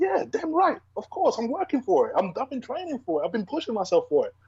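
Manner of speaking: high-pitched, insistent comeback; emphatic retort. Retold or imitated. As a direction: fire back at a doubter, pitch jumping up. Acted answer to a doubter's question.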